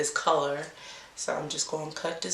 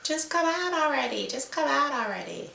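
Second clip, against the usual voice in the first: pitched-up frustrated coaxing; high exasperated plea; 9 semitones higher than usual. Pretend. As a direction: coax the braid in a high whine. Pleading with stuck braid.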